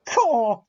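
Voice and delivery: loud high scoffing caw; indignant caw. A narrator's reading. James answering the boys' taunt with a loud indignant caw. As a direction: blurt it like an offended engine. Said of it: "said James".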